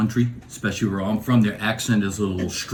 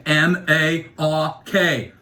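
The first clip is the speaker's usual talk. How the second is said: slow, letter by letter; spelling it out; 3 semitones higher, much slower than usual. Retold or imitated. East Coaster spelling his name for a clerk, slowly letter by letter. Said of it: "Could you spell that for me?".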